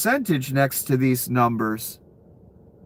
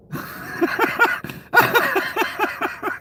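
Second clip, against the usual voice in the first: high wheezing laugh; breathless laugh. Real, not acted. Streamer wheezing with laughter at the bets. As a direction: laugh without voice in disbelief.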